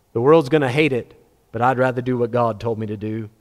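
ordinary delivery

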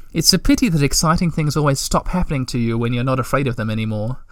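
ordinary delivery, nothing marked

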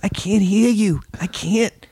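high, drawn-out prank-caller voice. Acted. Friend doing a Jerky Boys bit before the call, high prank voice. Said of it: "Jerky boys".

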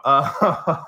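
ordinary talk, nothing marked

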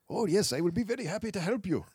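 high-pitched mock Indian accent; caricatured Indian-accented assistant voice; dapper Indian gentleman. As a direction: put on a lilting, eager-to-help Indian accent. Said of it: "like, a dapper Indian gentleman".

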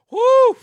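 high, loud "woo"; sudden whooping outburst. Real, not acted. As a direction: let out a big, high whoop of shock. Reacts to a picture.

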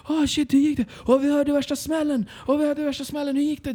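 high-pitched imitation of frantic villagers; alarmed onlookers. Locals rushing down to the crashed rider, high, frantic mimicry.

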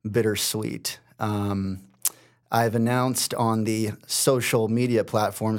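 ordinary delivery

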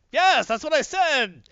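high, loud, slow hammed-up yes; mock proud agreement. Hercules cheerfully agreeing with the scientist. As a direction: beam it out, totally missing the point. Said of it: "It's like".

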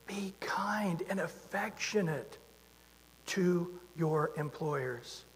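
whispered, slow and gentle; soft whisper. Acted. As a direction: breathe the words like a tender secret. Tender scripture quote, whispered.

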